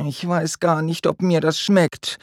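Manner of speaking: high, hesitant and unsure; doubtful. A narrator's reading. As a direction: sound wary of the pink pasta. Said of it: "sagt Lea zweifelnd".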